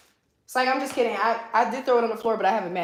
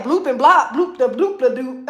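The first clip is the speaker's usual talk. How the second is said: mock gibberish reading; 3 semitones higher, 12 dB louder than usual. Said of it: "I said".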